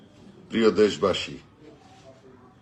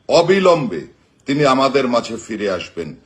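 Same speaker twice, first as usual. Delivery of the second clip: ordinary talk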